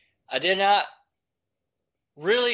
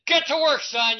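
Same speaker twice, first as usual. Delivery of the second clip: loud, high stern command; barked order; 8 semitones higher, 5 dB louder than usual. Retold or imitated. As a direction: order him back to work like a strict dad. Father ordering son again.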